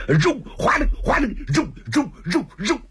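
high, sharp repeated bursts; imitated fork thrusts. Storyteller voices fork jabs at the leopard, sharp rhythmic bursts. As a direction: punch out each whooshing jab separately.

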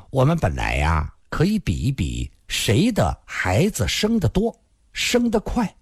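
usual speaking voice

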